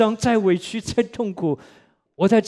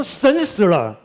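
loud, stark declaration; wife declaring boldly that God is dead; wife's retort; 6 dB louder, much slower than usual. Retold or imitated. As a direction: announce it like a shocking verdict. Wife's shocking retort in story.

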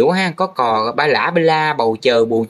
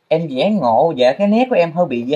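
normal talking voice

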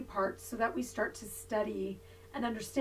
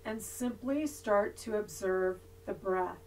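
soft, unhurried yoga nidra voice with long pauses; guided meditation; 2 semitones lower, 10 dB quieter than usual. Real, not acted. Yoga teacher leading resting students through body awareness, guided meditation voice. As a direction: guide them gently into body awareness, leaving long silences.

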